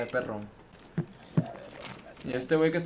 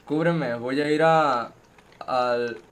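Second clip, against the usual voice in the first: normal talking voice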